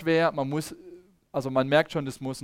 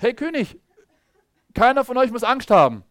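much higher and loud, fearless; bold call to the king; 8 semitones higher, 7 dB louder than usual. Acted. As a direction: announce it boldly to the king. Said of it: "So, sagt er jetzt zum König". (mutiger, lauter Ruf an den König)

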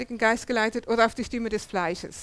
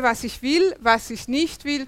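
ordinary talk, nothing marked

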